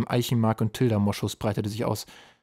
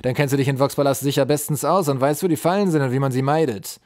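sly pressing; 4 semitones higher, 7 dB louder than usual. A narrator's reading. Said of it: "Er musterte den unglücklichen Troll listig".

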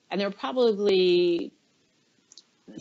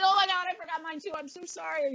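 panicked apology; 9 semitones higher, 5 dB quieter, faster than usual. Retold or imitated. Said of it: "I'm like".

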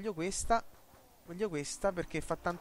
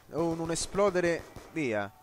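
ordinary talk

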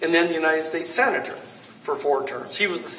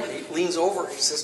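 usual speaking voice

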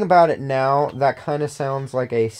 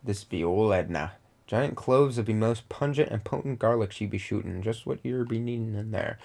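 put-on pirate accent; 4 semitones lower, 8 dB quieter than usual. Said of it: "do an accent".

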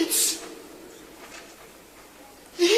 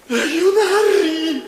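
a sobbing call for the soldiers; crying out in panic; 4 dB louder than usual. Acted. Blinded sorcerer calls the legionaries, crying. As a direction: cry for help half in tears.

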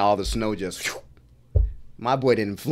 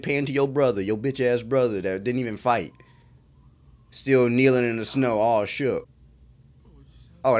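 normal talking voice

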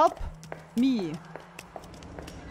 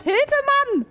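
screaming for help; 4 semitones higher, slower than usual. Real, not acted. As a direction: shriek for help in panic.

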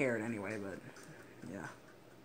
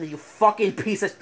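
very loud, high, drawn-out yell; real furious shout; rage outburst; 7 semitones higher, 14 dB louder than usual. Real, not acted. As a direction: explode at the game, then cut off. Raging at the game.

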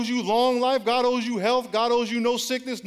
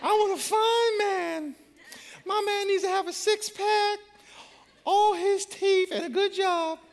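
mock woman's voice; 12 semitones higher than usual. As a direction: squeak out her wish list in a high put-on voice. Mocking a woman's wish list.

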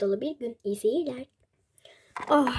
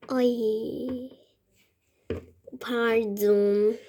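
ordinary delivery

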